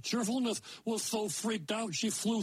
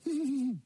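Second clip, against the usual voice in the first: high little giggle; girlish giggle; 8 semitones higher than usual. Acted. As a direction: giggle at the rude little man. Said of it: "They begin to giggle".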